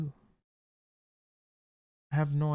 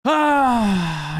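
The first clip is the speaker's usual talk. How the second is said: frustrated cry; 9 semitones higher, 9 dB louder than usual. Real, not acted. Blunder just lost the rook.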